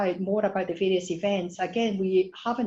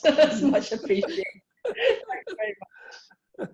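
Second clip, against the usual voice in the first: laughing; 8 semitones higher, 4 dB louder than usual. Real, not acted. Laughs at the accent joke.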